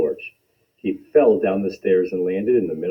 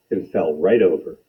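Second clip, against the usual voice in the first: ordinary delivery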